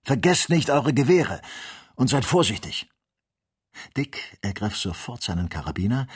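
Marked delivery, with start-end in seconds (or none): 0.0-2.9 raised, urgent warning call. A narrator's reading. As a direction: shout a reminder after departing companions. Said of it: "rief Ferguson". (laut und dringend hinterherrufen)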